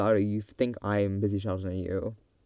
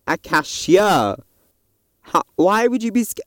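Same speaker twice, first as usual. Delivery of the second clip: loud, high exclamation of disbelief; indignant, loud and rising high; incredulous outburst; 9 semitones higher, 9 dB louder than usual. Real, not acted. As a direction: exclaim it in exasperated disbelief, pitched way up. Exclaims at her own fear.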